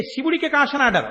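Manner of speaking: ordinary talk, nothing marked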